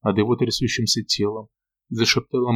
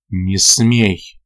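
louder, forceful prohibition; sharp command; 6 dB louder than usual. A narrator's reading. Lord stopping Ilona as she raises her hand, loud command. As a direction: forbid it with sudden force.

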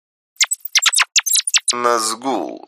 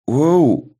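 sudden high, louder wow; surprised; 8 semitones higher, 3 dB louder than usual. A narrator's reading. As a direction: gasp it out in surprise. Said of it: "удивлённый голос Инара".

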